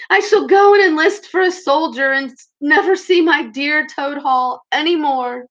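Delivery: high, loud, tearful lament; crying. A narrator's reading. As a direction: weep the words out, slowing at the end. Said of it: "crying into the soft cushions".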